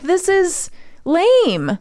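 slow, high, bored "This is lame"; bored drawl. Retold or imitated. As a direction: drag it out, unimpressed. Quoting own reaction, drawn out.

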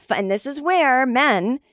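ordinary talk, nothing marked

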